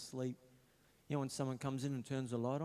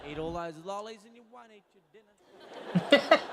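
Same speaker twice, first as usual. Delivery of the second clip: mum voice mocked; 8 semitones higher, 11 dB louder, slower than usual. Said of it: "She walked past and go".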